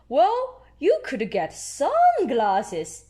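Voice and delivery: high drawn-out well, then slow; Mister Pony voice. Acted. Doctor suggesting sunglasses to Peppa, slow high voice. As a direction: offer the idea slowly.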